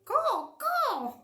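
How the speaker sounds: high, squawking caws; parrot squawk. Acted. Reading the parrot's line to children, high squawks. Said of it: "said the parrot".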